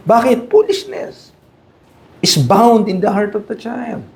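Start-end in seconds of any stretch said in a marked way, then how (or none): 0.5-1.3 Foolishness said high and drawn out; stressed foolishness. Shown as a demonstration. Preacher stressing Foolishness for parents, high drawn stress. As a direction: lift Foolishness way up and stretch it. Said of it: "repeats verse key word".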